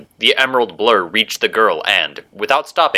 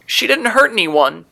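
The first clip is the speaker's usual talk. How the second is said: put-on very high, halting child voice; tearful little girl; 14 semitones higher than usual. A narrator's reading. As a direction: falter as a small girl near tears. Tearful girl defends her mother.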